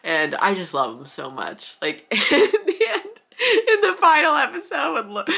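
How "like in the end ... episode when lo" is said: high, louder, laugh and sob mixed; real laugh cracking into crying; laughing through tears. Real, not acted. Crying over the finale.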